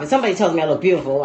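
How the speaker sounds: normal talking voice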